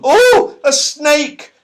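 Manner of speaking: high, loud frightened cry; cry of alarm. A narrator's reading. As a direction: shriek it in sudden fright. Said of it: "cried Beth in alarm".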